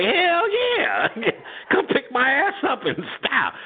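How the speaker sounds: high, gushing yes; eager relief. Retold or imitated. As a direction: jump at the ride, giddy. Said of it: "the real answer is like".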